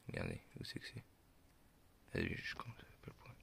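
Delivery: whispered under the breath; whispering. Real, not acted. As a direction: murmur it to yourself. Muttering while reading a label.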